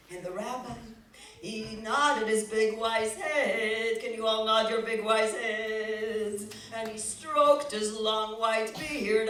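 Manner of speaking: slow, stately storytelling; slow reverent storybook voice; solemn narration. A narrator's reading. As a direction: describe the wise rabbi slowly.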